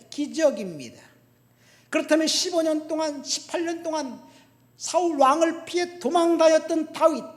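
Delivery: loud, high preacher's delivery; forceful preaching. Real, not acted. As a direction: drive it home to the congregation.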